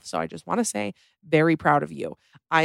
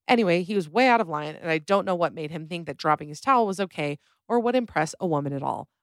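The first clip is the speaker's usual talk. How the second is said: normal talking voice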